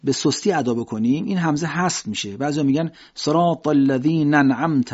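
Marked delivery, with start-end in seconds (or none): none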